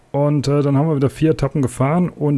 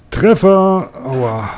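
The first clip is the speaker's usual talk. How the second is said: hit hard crying out; 4 dB louder, much slower than usual. Real, not acted. Car crashes into obstacle.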